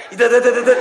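loud yelping ouch, half laughing; mock pain. Fool acting out being pinched by his ghost lover, loud mock yelp. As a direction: yelp like your cheek is pinched.

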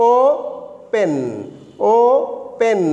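"ওপেন" very slowly, ও and পেন apart; long ও, a gap, then a drawn-out পেন in "ওপেন"; syllables pronounced separately. Shown as a demonstration. Students told to watch lips and tongue, "ওপেন" split slowly as ও, পেন. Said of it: "দুটাই আলাদা আলাদাভাবে উচ্চারণ হচ্ছে".

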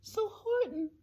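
high, soft little voice; small voice reporting troubles from far below; plaintive, tiny high-pitched mayor. A narrator's reading. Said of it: "voice of the mayor".

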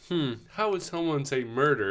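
musing under breath. Acted. Host voices the stumped interpreter, musing softly. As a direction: softly puzzle it out in mock thought. Voicing the fake interpreter's thoughts.

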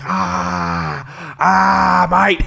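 Australian sufferer's hammed-up yell; mock pained cry. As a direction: parody of agony, Australian style. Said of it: "He's in pain".

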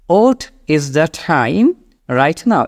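"What" as "ot", aspirated T in "time"; very slow, round O opening "What". Shown as a demonstration. Teacher recaps for learners, slow "What" said as "ot". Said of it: "তাহলে এখানে কেমন হলো".

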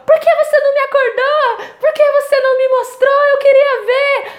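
mock the upset sister complaining she wasn't woken; hammed-up sulky sister voice; angry sister. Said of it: "A minha irmã ficou tão brava".

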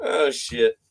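ordinary talk

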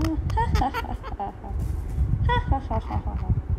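loud open laughing fit; hearty real laugh; real laughter. Real, not acted. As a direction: burst out laughing at the bird. Laughing at parrot.

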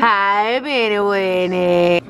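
slow, drawn-out coo at the dog; doggy baby talk. Acted. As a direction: stretch it out like baby talk to a pet. Cooing to her dog.

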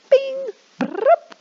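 high playful elevator noise; elevator ding and whirr. Shown as a demonstration. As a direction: mimic the elevator for kids. Elevator sound effects for kids.

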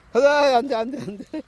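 high, loud call to the dog; talking to a dog. Real, not acted. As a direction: yell at the dog to stop. Stopping the dog. (강아지에게 높고 크게 외치기)

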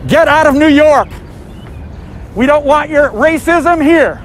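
shouting slogans at a crowd; loud protest shout. Real, not acted.

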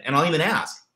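normal talking voice

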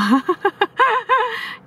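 long, very high laughter; jeering laugh. Acted. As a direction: burst out laughing at him. Young men laugh at him. (cười ồ lên)

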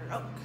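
loud snoring snort; honking mock snore. A narrator's reading. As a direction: snort in your sleep like a bear. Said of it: "snorted Mister Bear".

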